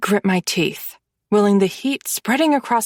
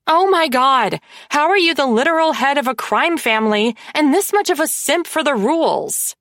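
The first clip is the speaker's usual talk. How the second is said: exasperated; 7 semitones higher than usual. Acted. Exasperated sister mocks brother.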